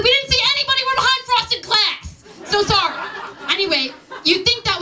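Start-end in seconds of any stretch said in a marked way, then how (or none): 0.0-2.9 much higher, politely apologetic put-on voice; a hint of an apologetic clerk behind glass; polite staff voice. Imagined reply of hotel staff.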